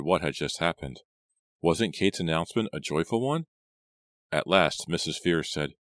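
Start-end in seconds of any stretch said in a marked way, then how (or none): none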